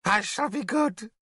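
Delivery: a blinded wizard begging; broken old Merlin. Acted. Blind mad Merlin pleads.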